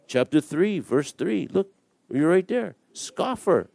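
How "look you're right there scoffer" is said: normal talking voice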